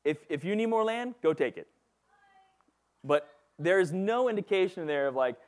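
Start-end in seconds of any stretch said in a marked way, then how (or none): 0.0-1.7 mock dismissive. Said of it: "He's like".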